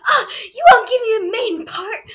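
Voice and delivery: high, loud whiny tantrum voice; drama queen whine. Retold or imitated. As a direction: whine like a spoiled diva. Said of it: "such a drama queen".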